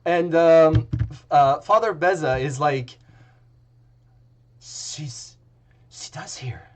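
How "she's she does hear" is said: hushed, breathless whisper; awed whisper. Acted. Priest whispers in awe that the goddess heard him.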